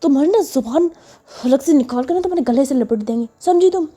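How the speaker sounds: a hammed-up angry rant; angry threat. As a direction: snap at the nagging child. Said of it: "गुस्से में".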